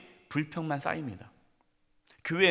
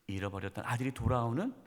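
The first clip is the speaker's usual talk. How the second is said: whisper; 5 semitones lower, 5 dB quieter than usual. Real, not acted. Whispered climax of parables.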